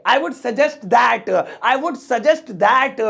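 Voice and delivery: drawn-out words for learners; careful, slow phrase to copy; slow teaching model. Shown as a demonstration. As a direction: model each English word slowly.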